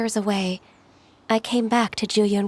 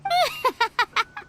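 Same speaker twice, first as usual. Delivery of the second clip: awkward laugh; 14 semitones higher than usual. Acted. Ganyu embarrassed by stories.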